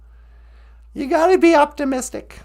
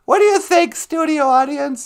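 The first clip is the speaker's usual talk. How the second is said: usual speaking voice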